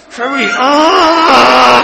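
exasperated aside, then long groan; acted-out irritation with a drawn-out "ah"; irritated impatience. Husband fed up with wife's long praying, irritated groan. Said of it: "That shit was so irritating".

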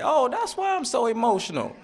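high, surprised realization in mom's voice; mother's voice. Retold or imitated. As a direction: lift it high as mom suddenly understands.

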